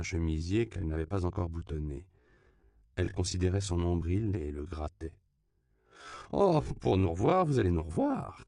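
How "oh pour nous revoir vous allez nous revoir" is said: higher, louder assurance; emphatic confirmation. A narrator's reading. Woman assures the man they will meet, emphatically confirming. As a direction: confirm it with a swooping, knowing emphasis. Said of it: "confirma Myriam".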